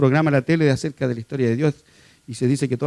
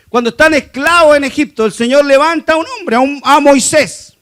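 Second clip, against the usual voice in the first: louder, higher, driving sermon voice; fiery preaching; 9 semitones higher, 7 dB louder than usual. Acted. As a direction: preach it from the pulpit with full force. Preaching at full force. (predicación enérgica, fuerte y aguda)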